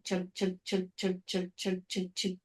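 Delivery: soft, low, evenly clicking chugs; roller coaster chugging. Retold or imitated. Host mimics a roller coaster climbing, soft mechanical chugging. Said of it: "roller coaster of the chug".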